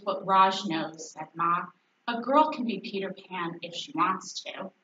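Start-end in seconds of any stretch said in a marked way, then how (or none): none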